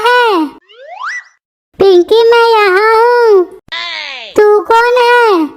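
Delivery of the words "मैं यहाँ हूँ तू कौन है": whimpering, slow crying question. Acted. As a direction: cry out in distress. (रोती हुई आवाज़)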